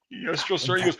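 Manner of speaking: small, high, meek yes; meek reply. Retold or imitated. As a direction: answer like a cowed private. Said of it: "he goes".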